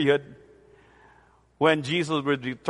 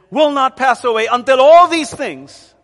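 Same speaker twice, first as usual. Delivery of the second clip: louder, higher proclamation of "Will not pass away"; emphatic declaration; 6 semitones higher, 9 dB louder than usual. Shown as a demonstration. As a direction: ring it out as the third emphasis. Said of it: "triple emphasis".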